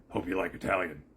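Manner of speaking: a quick, lightly put-on rascal ghost voice; Beetlejuice. Parent surprising youngest child while cooking, movie ghost voice. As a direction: toss it off as a movie ghost impression. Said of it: "do Beetlejuice's voice".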